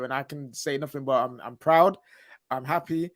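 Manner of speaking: ordinary delivery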